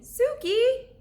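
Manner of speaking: high, loud call of the name; girl calling her dolphin friend back. Acted. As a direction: shout the name after her. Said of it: "called Annie".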